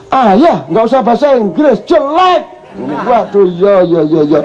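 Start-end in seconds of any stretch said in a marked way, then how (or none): 0.1-2.6 put-on high dismissive child voice; daughter's scolding. Daughter telling father to stop speaking English, high and dismissive. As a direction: brush dad off, pitched high. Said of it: "gitu kan".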